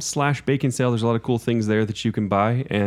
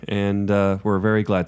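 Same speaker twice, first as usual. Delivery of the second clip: normal talking voice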